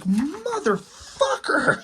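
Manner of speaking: drawn-out, high-pitched cartoon rage; super pissed off, played for laughs; mock freak-out. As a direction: stretch it out as a hammed-up furious curse. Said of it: "and he freaks out".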